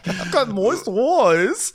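very high, slow weepy squeak; milk the fake tears; mock teary. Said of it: "[name]'s crying".

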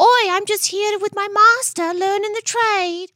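put-on high, slow, eager little apprentice boy; old-time apprentice. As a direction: play the doctor's boy learning at his master's side. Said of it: "an apprentice?".

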